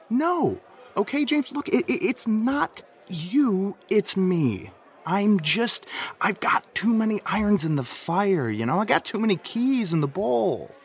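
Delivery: high-pitched, overwrought pleading; high, emotional breakup speech to James; worked-up pleading. Acted.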